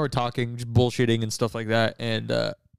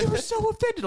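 high mock teasing. Retelling the offended exchange.

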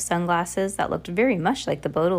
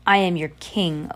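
usual speaking voice

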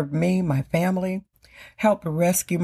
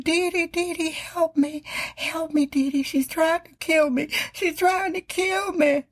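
put-on much higher, pleading cry for help from an old mother; imitating terrified mother; 11 semitones higher than usual. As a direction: mimic a frightened mother begging her daughter on the phone. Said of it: "That's a pretty good imitation of my mother".

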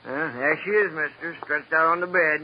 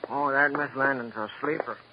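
ordinary talk

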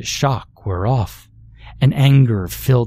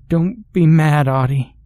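put-on higher-pitched timid little girl; 5 semitones higher, 4 dB louder than usual. A narrator's reading. Little sister pleads with her older sister, timid little voice. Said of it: "the sound of the timid little voice".

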